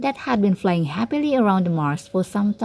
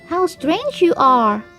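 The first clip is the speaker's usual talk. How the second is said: slow, high, louder astonished cry; exclaiming in surprise; 8 semitones higher, 3 dB louder, slower than usual. A narrator's reading. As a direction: gasp it out at the odd chick. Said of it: "exclaimed the other ducklings".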